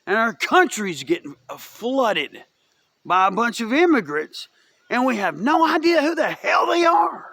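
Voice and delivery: building to a high, exasperated shout; angry rant. Real, not acted. Vlogger berating Democrats about immigrants, increasingly angry. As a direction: let the rant climb into yelling.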